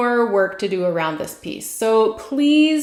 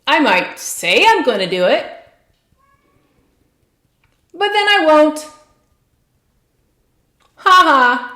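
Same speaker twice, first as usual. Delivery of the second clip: defiant anger. Acted. Tapping client voicing her inner rebel, defiant and angry. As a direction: talk back like a rebellious kid refusing orders. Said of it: "some anger and some defiant energy arose there".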